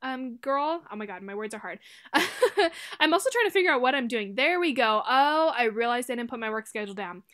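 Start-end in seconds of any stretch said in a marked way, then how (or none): 1.8-3.0 loud, high laugh at her own stumble; burst of laughter. Real, not acted. Laughing at her own tangled words to viewers, bright burst of laughter. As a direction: crack up at tripping over words.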